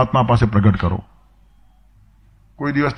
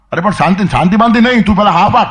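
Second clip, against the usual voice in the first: high, loud panicked cry for mercy; frantic plea; 7 semitones higher, 4 dB louder than usual. Retold or imitated. Man being beaten begs the minister for mercy, loud and high. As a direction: beg him to stop, rising in pitch.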